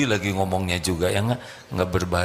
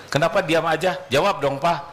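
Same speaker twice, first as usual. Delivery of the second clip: demanding wife; 6 semitones higher than usual. Retold or imitated. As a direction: push him to answer.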